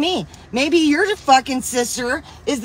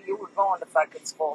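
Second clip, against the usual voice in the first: lower, softer, no-nonsense aunt laying down the law; stern aunt; 6 semitones lower, 7 dB quieter than usual. Retold or imitated. Aunt telling the kid she's going to school, stern and low. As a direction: voice the aunt who didn't play, flat firm order.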